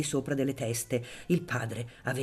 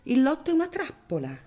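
put-on market girl; 11 semitones higher, 3 dB louder than usual. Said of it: "rispose costei".

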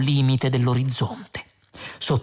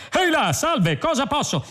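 much higher, sing-song machine; chirpy ship computer; 13 semitones higher than usual. A narrator's reading. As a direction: pop up eagerly. Computer answers Zaphod.

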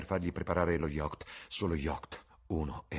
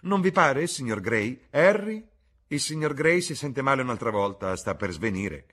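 high, loud alarm; alarmed exclamation; 7 semitones higher, 8 dB louder than usual. Acted. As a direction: call out in concern as someone faints. Duchess sees Dorian about to faint. (esclamazione allarmata, acuta e forte)

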